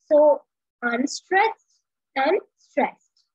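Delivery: "unstressed and stressed" drawn out slowly, "stressed" leaned on; beat demo. Shown as a demonstration. Teacher demonstrating iambic meter: slow, emphatic beat. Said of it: "unstressed syllable and a stressed syllable".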